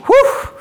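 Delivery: high, loud whoop of a breath; cheerful breathy whew. Real, not acted. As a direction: blow out a happy whew. Exhaling after marching.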